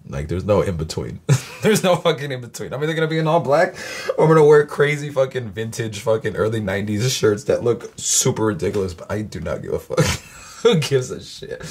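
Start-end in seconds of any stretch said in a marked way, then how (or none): 1.6-4.2 laughing through the words. Real, not acted. Laughing about his style. 10.6-11.6 slow, laughing shrug. Real, not acted. Laughing, carefree.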